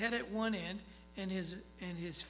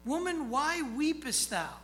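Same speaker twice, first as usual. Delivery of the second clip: solemn scripture reading; 6 semitones higher, 7 dB louder than usual. Acted. Reads angel's words from scripture.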